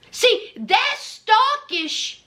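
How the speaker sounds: slow, drawn-out playful scolding; slow teasing. Acted. Vlogger teasing viewers who never subscribe, slow and drawn out. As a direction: drag it out to tease them. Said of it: "teasing viewers slowly".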